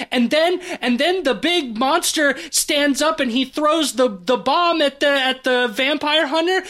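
put-on breathless, high excited child voice; excited kid rambling. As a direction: gush it out like an overexcited little kid. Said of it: "It feels like a little child that's being like".

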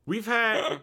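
hammed-up cracking voice; voice breaking. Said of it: "I'm getting a little choked up".

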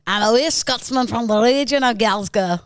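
overdone amateur Scottish; best Scottish attempt. Shown as a demonstration. Podcaster giving a best Glasgow accent, overdone. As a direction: lay the bad Scottish on thick. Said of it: "give me your best".

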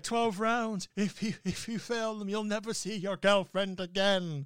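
loud, high, hammed-up Irish villain; bad Irish accent. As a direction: threaten them in a badly faked Irish accent. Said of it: "he's doing his normal Irish accent".

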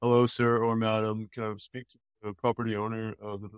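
stiff scripted cold-call opener, lightly mocked; stock telemarketer voice. Mocking telemarketers by reading their stock opener in a canned way. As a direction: read it like a bored phone script. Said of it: "it's not".

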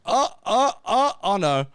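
high, loud repeated cries of alarm; mock dismay. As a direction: act out the character's dawning horror, hammed up. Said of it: "And he's like".

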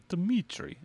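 slow Russian accent with a heavy tongue; Russian accent, tongue swollen and thick. Shown as a demonstration. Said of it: "your tongue's heavy".

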